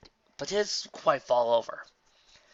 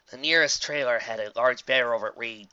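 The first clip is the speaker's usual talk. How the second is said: ordinary delivery, nothing marked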